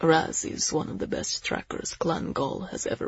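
hushed, sombre warning; grave Zell. Acted. Zell warning the group of hunters, grave.

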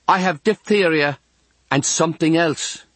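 put-on high girlish chatter; girl's voice. A narrator's reading. Girl to younger boy next door, girlish voice. Girl chats through wall.

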